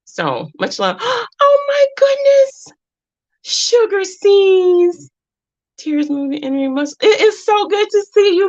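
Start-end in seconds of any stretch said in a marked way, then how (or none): 1.0-7.0 gasping, much higher and louder, stretched slow; a squealing gasp of joy, drawn out; delighted gasp. Real, not acted. Gushing greeting to a returning viewer. 7.0-8.5 much higher and louder, gushing with joy; light up as if seeing a long-lost friend; squealed welcome, high and loud; excited greeting. Real, not acted. Gushing greeting to a returning viewer.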